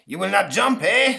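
loud, high mock-grand ghost; ghost Napoleon. Ghost Napoleon threatening the boy from above, mock grand. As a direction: loom over him.

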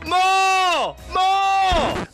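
high, drawn-out cheering yell; encore shout. Real, not acted. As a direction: holler like a crowd demanding an encore. Chanting for an encore.

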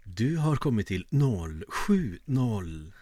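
slow answering-machine voice reading out digits; telefonsvarare. Acted. Podcast host mimics a phone message, answering-machine voice. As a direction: draw out each number like a recorded message. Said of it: "Som telefonsvarare när de ska säga nummer".

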